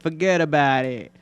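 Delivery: put-on playful New York accent; put-on New York wiseguy phrasing. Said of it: "I would love to have a New York accent".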